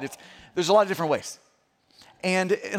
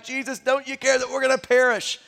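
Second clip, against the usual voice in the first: mock-frightened plea, pitched higher; panicked disciples; 5 semitones higher than usual. Preacher retelling the frightened disciples waking Jesus, mock panic. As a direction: voice the disciples' alarm, lightly. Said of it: "they're like".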